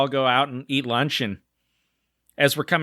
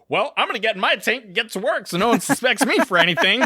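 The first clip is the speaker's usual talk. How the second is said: mock innocent; 8 semitones higher than usual. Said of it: "And I was like".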